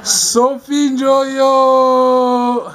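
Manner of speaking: long drawn-out shout of a name; calling out. Real, not acted. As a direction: call her name, stretching it. Calling the child's name loudly.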